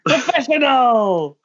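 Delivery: high, slow, drawn-out shout; hammed-up cheer to kick off the take; mock grand shout. Friend jokingly hypes the recording, drawn-out mock shout.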